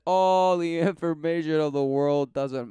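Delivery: high, loud mocking tone. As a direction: exaggerate the sneer. Mocking the guy's claim.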